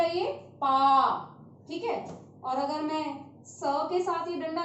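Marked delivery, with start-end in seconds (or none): 0.0-1.2 पा with a long, open आ; slow teaching demo of पा; slow drawn-out pa. Shown as a demonstration. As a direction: stretch out पा slowly. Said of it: "डंडा लग के क्या बन गया".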